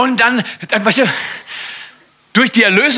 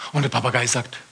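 ordinary delivery

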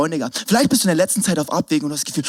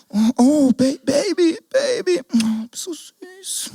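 put-on cooing baby talk; 9 semitones higher, much slower than usual. Big cousin cooing to the fallen toddler, baby talk. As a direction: coo like over a hurt toddler.

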